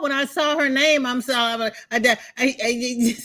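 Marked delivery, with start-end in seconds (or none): 1.9-3.2 flustered stutter on a repeated I. Real, not acted. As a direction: stumble over the word in a fluster. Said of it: "I was stuttering".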